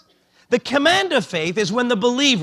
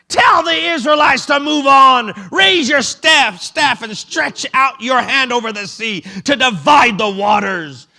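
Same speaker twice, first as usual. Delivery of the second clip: God commanding; 2 semitones higher, 5 dB louder than usual. Retold or imitated. The Lord telling Moses to split the sea, shouting. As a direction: order him onward with full force. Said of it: "Then the Lord said to Moses".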